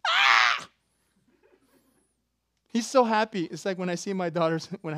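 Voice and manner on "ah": short, loud mock shriek; ecstatic shriek. Shown as a demonstration. Preacher shows what exult means, loud ecstatic shriek. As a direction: shriek as if overjoyed. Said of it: "translated to shriek ecstatically".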